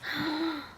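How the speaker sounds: real startled gasp; quick audible intake of breath; sharp gasp. Real, not acted. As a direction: gasp at a sudden discovery. Reader reacts to card message.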